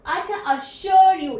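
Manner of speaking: teacher demonstrating emphasis on "assure"; stress on assure. Shown as a demonstration. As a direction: lean hard on "assure", drawing it out. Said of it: "इसमें थोड़ा सा stress दिया है".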